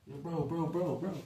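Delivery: acted "whoa, bro" interruption; friend intervening. Friend cutting off someone too loud.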